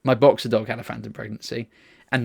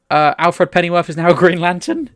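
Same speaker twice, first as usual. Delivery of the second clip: excited; 6 semitones higher, 7 dB louder than usual. Real, not acted. Announcing surprising comic news.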